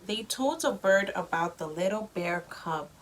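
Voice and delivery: ordinary delivery, nothing marked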